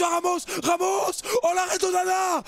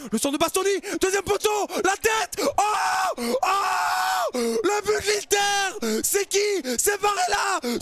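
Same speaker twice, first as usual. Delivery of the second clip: goal call; 12 semitones higher, slower than usual. Acted. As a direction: scream the goal like a TV commentator. Goal celebration commentary.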